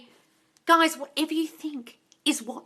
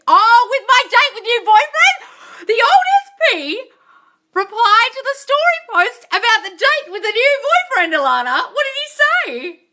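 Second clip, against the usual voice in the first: excited reading; 8 semitones higher, 7 dB louder than usual. Real, not acted. Reading a follower's juicy comment, excited. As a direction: read it out amazed and gleeful.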